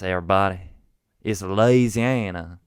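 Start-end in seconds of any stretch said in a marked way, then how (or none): 1.2-2.6 loud, drawn-out Southern drawl; Southern accent. Shown as a demonstration. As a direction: proclaim it slowly in a Southern accent. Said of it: "this Southern accent is gonna be hard to shake".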